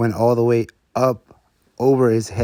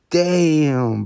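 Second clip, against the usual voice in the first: impressed damn; 6 semitones higher, 3 dB louder than usual. Retold or imitated. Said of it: "And I was like".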